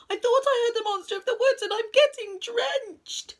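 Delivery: high, loud rush, stretched at the end; panicked cry. A narrator's reading. Rabbit begs Owl for shelter from the monster, panicked cry. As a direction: gabble in alarm, then wail the last word. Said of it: "cried Rabbit".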